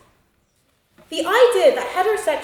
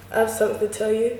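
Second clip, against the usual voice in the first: low, hesitant confession; 4 semitones lower than usual. Acted. Man confessing to his best friend, hesitant. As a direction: say it haltingly, a little low. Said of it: "utters a hesitant".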